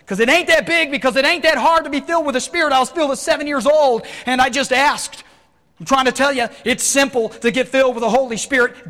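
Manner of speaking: loud, high-pitched impassioned sermon; full-force preaching. Real, not acted.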